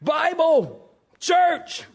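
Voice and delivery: high-pitched, desperate cries; panicked shouting. A narrator's reading. Rider nearing cliff edge, shouting in panic. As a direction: fire off frantic guesses at a magic word. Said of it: "shouted the man".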